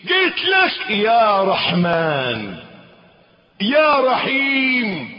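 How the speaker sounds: slow, high, loud plea to the Merciful; pleading cry. Pretend. A servant crying out to his Lord, slow and loud. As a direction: stretch out the cry for mercy. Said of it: "كأنك بتقول".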